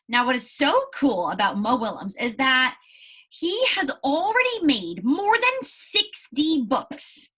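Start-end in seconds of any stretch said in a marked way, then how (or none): 3.3-7.3 amazed emphasis. Real, not acted. Teacher marvels at book count.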